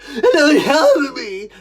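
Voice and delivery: loud, sobbing wail; tearful cry. Retold or imitated. Child nobody is helping, wailing tearfully. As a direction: cry it out through sobs. Reliving choking with no help.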